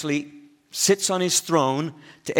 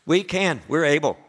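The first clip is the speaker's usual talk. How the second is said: higher, louder, brimming with confidence; confident answer; 5 semitones higher, 5 dB louder than usual. Retold or imitated. Disciples telling Jesus they can, confidently. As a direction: answer eagerly, sure of yourself.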